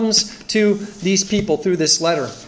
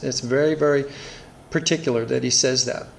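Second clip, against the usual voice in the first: ordinary talk, nothing marked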